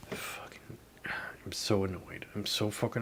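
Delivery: annoyed whisper under the breath. Real, not acted. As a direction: hiss out the frustration quietly. Venting frustration to himself.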